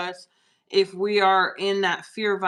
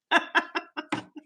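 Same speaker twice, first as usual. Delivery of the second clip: short high giggle; high laugh; 9 semitones higher than usual. Real, not acted. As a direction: let out a quick high laugh. Laughs about frozen face.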